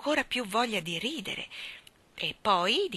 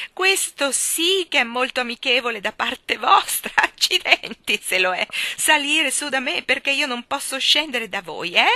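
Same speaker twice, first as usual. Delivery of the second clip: louder, higher, chuckling as he talks; laughing through words; 5 semitones higher, 7 dB louder than usual. Acted. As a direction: speak through bursts of laughter. Wigg laughing while talking.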